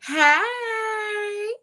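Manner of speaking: high drawn-out squeal; real high-pitched delight; excited greeting. Real, not acted. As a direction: sing out a long, high hello. Excited greeting as guest joins.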